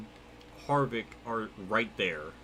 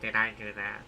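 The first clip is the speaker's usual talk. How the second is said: Steve Urkel; 4 semitones lower than usual. Pretend. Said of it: "do your best Steve Urkel".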